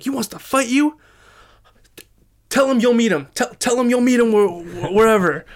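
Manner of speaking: loud, high, outraged father impression; mock berserk yelling, stumbling over the words; berserk dad. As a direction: imitate a fired-up dad shouting orders. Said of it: "my dad goes berserk".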